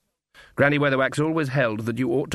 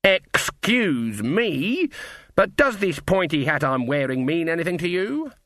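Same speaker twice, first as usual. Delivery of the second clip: higher, drawn-out opening, then a pointed rising question; menacing indignation; 5 semitones higher, slower than usual. Acted. Granny challenging the captain about her pointy hat, menacingly indignant. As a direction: demand respect with icy politeness. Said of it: "undertones as are carried by words like charge and kill".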